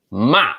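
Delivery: ma dropped hard like a command; fourth tone. Shown as a demonstration. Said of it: "So it's falling".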